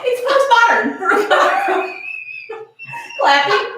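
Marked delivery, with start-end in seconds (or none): 1.0-3.1 giggling laugh; high-pitched giggle. Real, not acted.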